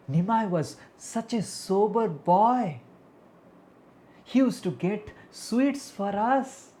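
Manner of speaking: high, slow, drawn-out praise; lilting, emphatic village-lady voice; reminiscing ladies. Acted. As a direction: marvel at how good the boy used to be. Ladies recalling the good boy.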